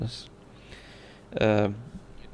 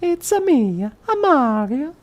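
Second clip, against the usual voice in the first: very high, slow Italian plumber voice; Mario; 5 dB louder, slower than usual. Acted. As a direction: squeal it out like the cartoon plumber with an Italian accent.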